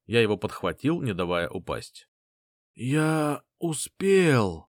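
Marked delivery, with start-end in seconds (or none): 2.8-4.7 dying rasped words, very slow; hoarse wheeze. Acted. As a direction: croak it out weakly, slowly. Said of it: "прохрипел он".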